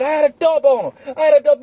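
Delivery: high, loud brag; proud excited boast. Retold or imitated. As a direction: show off the gun, still wired. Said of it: "I said".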